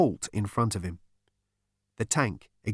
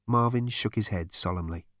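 ordinary delivery, nothing marked

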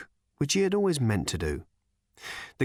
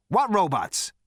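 sharp; 9 semitones higher, 4 dB louder than usual. Acted. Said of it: "said Zaphod sharply".

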